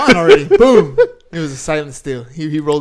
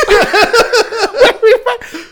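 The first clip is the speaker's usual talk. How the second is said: much higher and louder, slow and stretched through a laugh; laughing mock wail; 9 dB louder, much slower than usual. Repeating the wife's question to his friend with a laughing high wail. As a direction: wail the question comically while cracking up.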